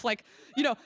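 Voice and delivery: normal talking voice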